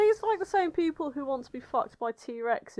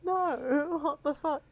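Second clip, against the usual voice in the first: laughing disbelief; 5 semitones higher, 3 dB quieter, slower than usual. Real, not acted. Shocked by image, laughing.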